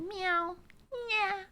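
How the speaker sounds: high, small mewing; cat meow. Acted. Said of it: "should have just been a cat".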